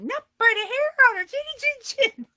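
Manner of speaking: very high, loud sing-song squeal; unrestrained storybook taunt; gleeful taunt. Retold or imitated. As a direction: shriek it with tipsy glee. Drunk taunt repeated louder.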